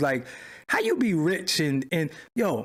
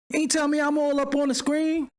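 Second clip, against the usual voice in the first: higher, scolding the chat in disbelief; exasperated complaint; 9 semitones higher than usual. Real, not acted. Streamer scolds his chat for not warning him, exasperated. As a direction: pitch up and complain to the audience.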